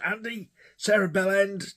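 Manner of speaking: high-pitched, gleeful call; retold high-pitched shout-out; calling out to a friend.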